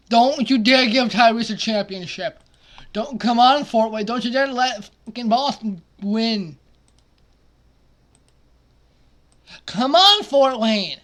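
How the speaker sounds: loud pleading yell at the screen; begging the team to win, loud; shouting at the game. Real, not acted. Gamer yelling at his sim team, loud and desperate.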